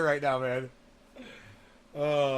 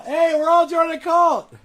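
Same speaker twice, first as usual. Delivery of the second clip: high, loud gleeful yell; excited shout; 12 semitones higher, 11 dB louder than usual. Shown as a demonstration. Mocking his critics with a gleeful shout. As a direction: shout it out like a cheer. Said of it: "same words, shouted".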